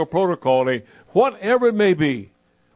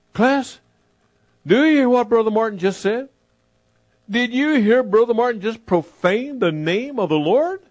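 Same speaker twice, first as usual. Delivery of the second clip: raised, scolding call to the class; indignant teacher; 6 semitones higher than usual. Retold or imitated. Missionary shocked, tells the class he profaned God. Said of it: "looked at the rest of the class, and said".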